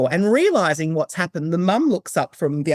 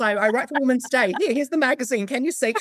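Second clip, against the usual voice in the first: excited rush; 9 semitones higher than usual. Retold or imitated. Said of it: "I actually ran over and said".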